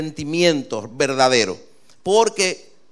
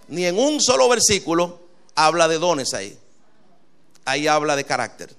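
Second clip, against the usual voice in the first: normal talking voice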